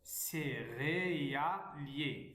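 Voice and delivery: céréalier broken slowly into cé, ré, a, lier; céréalier drawn out with pauses between syllables. Shown as a demonstration. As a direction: say céréalier one held syllable at a time. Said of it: "lire les mots syllabiquement".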